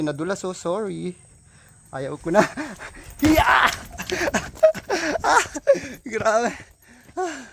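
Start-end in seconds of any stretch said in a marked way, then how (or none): 3.2-3.5 high-pitched laughing yelp. Real, not acted. Slipping on the steep trail. 3.5-5.2 high, squealing burst of laughter; shrieking laugh. Real, not acted. Laughing after nearly slipping.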